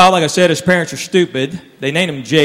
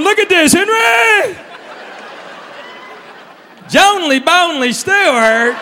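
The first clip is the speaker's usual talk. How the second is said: much higher, overjoyed, showing off the name; proud excited buddy; slower than usual. Retold or imitated. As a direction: crow about it like a proud fool. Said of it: "He was going".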